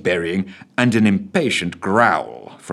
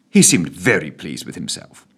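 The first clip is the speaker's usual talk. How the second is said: ordinary delivery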